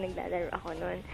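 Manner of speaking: normal talking voice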